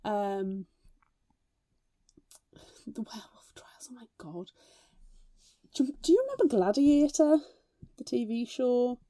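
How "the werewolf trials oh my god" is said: hushed whisper of disbelief; mock-horrified whisper; whispered dismay. Real, not acted. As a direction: whisper it like a guilty secret. Whispered dread about the trials.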